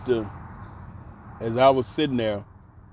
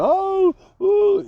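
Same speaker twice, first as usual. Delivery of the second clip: high loud mock sob; mock wailing; 5 dB louder than usual. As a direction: ham up loud crying to contrast quiet tears. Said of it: "the quiet kind of tears".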